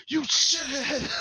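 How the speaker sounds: high, drawn-out mock scream; hammed-up sudden fury, stretched out; really mad. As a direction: burst into a squeezed falsetto insult. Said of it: "he's really mad".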